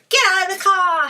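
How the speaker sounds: sudden loud commanding shout; yelling at him. Retold or imitated. Harley yells at the Joker to leave the car. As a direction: bark the order at full volume.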